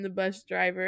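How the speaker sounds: ordinary talk, nothing marked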